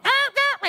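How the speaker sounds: high yelping dog imitation, muffled; imitating a yapping dog's cries; dog yelps. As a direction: squeak it out like a hurt little dog. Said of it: "Our dog is going".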